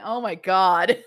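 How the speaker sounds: usual speaking voice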